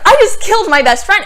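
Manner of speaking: high, loud, fast panicked cry; freaking out. Retold or imitated. Veronica realizing she killed her best friend, frantic. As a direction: blurt it out in horror. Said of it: "and she was freaking out".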